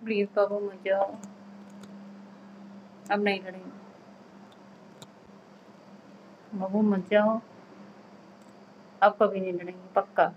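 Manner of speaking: very soft, low, subdued begging; coaxing a partner to stay, barely raising the voice; quiet pleading. Real, not acted. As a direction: plead in a small hushed voice, with long silences. Wife begs husband not to leave.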